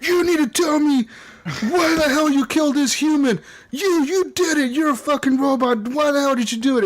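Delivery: high, pushy interrogator voice; ruwe ondervrager. Retold or imitated. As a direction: grill the robot hard, mock-tough. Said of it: "I was rough on him".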